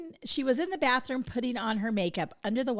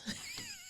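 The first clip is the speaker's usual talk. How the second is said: high laugh; 15 dB quieter than usual. Real, not acted. Laughing at joke.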